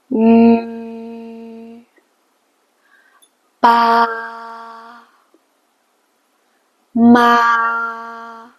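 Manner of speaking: each syllable held level and even; flat first tone. Shown as a demonstration. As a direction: keep every syllable perfectly level like a tired old person. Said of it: "Everything is flat".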